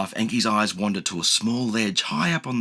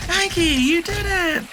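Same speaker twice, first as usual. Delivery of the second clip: very high slow joyful cheer; 4 dB louder, slower than usual. A narrator's reading. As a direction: celebrate each word. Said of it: "they cheer".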